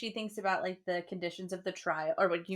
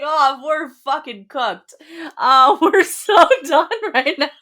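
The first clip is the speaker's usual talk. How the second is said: a loud, slow, laughing 'we're cooked'; laughing quote; 6 semitones higher, 15 dB louder, slower than usual. Retold or imitated. Rhys laughing to himself at Feyre's guess, loud and slow. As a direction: crack up while voicing his dismay. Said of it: "He's like".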